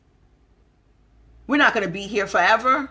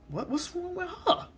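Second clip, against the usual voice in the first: judgy mutter; 8 semitones higher, 8 dB quieter than usual. Retold or imitated. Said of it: "And you like".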